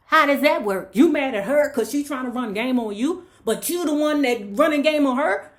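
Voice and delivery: loud, high-pitched challenge; indignant scolding. Acted. Storyteller calling out Winter for running game on the landlady, indignant.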